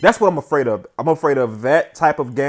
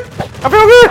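much higher, loud yell of joy; joyous shout; 8 dB louder, slower than usual. Real, not acted. Gamer shouting his relief after the win, joyous yell. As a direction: belt it out in triumph.

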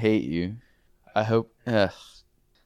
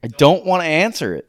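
louder, higher and insistent; loud refusal; 5 semitones higher, 6 dB louder than usual. Real, not acted. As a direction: shout the refusal, fed up. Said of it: "I'm not gonna answer this question".

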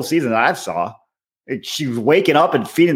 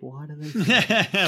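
quoting a TV line; 7 dB quieter, slower than usual. Retold or imitated. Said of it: "American Dad".

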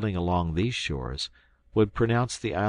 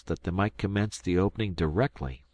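plain reading voice

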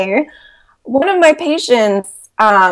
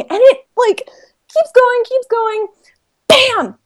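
excited; 13 semitones higher, slower than usual. Real, not acted. Robot slams into patient bed.

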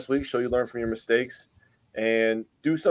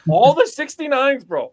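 high, loud gleeful outburst; real excited holler, pitched way up; excited whoop; 14 semitones higher, 7 dB louder than usual. Real, not acted. Excited joking about the number.

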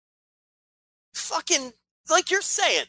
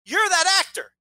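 higher, eager hello to an actor; a light mock of a fan stopping an actor; mock fan greeting; 9 semitones higher than usual. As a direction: play an overeager stranger on the street.

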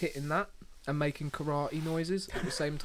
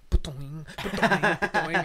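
loud mock "ba-doing" clangs, climbing; imitated bin clang; 3 semitones higher, 8 dB louder than usual. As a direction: bang it out like a stick on a bin. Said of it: "but I just heard".

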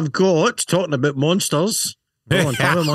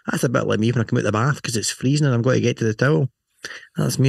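normal talking voice

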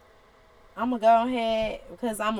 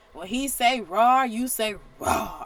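ordinary delivery

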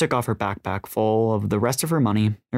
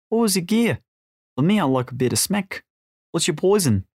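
put-on higher, nosy Australian accent; casual Australian small talk, pitched up; Australian smuggler James, chatty; 8 semitones higher than usual. A narrator's reading. James quizzing Jess about drugs, chatty Australian.

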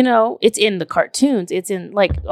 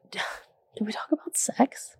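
unvoiced teasing whisper, higher; 4 semitones higher, 10 dB quieter than usual. Whispering a teasing question to her TikTok viewers. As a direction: breathe it as a mock secret. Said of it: "I'm gonna ask you".

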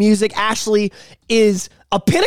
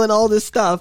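ordinary talk, nothing marked

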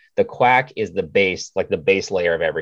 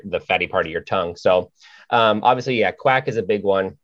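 ordinary delivery

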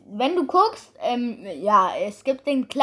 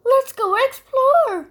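cartoon character voice; 11 semitones higher, 4 dB louder, slower than usual. Copying a game voice line.

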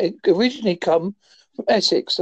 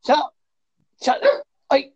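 sharp, higher-pitched shut up at the dog; telling off the dog; 7 semitones higher than usual. Real, not acted. Scolding the barking dog in the background, sharp and raised. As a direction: snap at the noisy dog to hush.

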